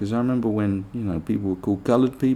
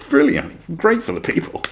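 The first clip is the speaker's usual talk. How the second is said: mock fan praise; 11 semitones higher than usual. Mocking applauding fans.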